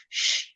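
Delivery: long hissed shh through the front teeth; teacher's model shh; demo sh sound. Shown as a demonstration. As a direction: push air out in a steady shh. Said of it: "It must go out the front".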